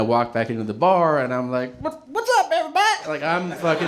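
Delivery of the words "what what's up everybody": put-on high-pitched, over-friendly drunk greeting; drunken hollering greeting. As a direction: greet the whole bar in a high, boozy voice.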